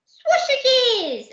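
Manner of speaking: put-on very high, squealing toddler voice; a delighted little boy shouting his favourite food; baby voice. Baby tells the pirates what he wants in a squeaky baby voice. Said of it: "he said".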